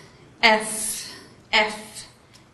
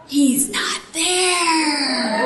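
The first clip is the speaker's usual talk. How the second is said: long, drawn-out joyful call, pitched higher; retold elation; 4 semitones higher, much slower than usual. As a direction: stretch it out in mock-celebration. Retold joy at instructor's absence.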